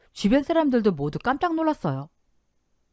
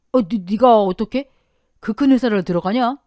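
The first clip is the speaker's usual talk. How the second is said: stunned disbelief; 3 semitones higher, 7 dB louder than usual. A narrator's reading. Uncle stunned by the news.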